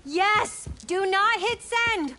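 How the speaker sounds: loud, slow alarmed yell; urgent shout. Real, not acted. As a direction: shout to stop him in time. Stopping a bad post.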